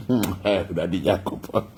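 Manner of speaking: a mock groaning Daddy Jacob, low and soft. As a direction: mimic someone caught out, low and hushed. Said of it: "and you might catch and say".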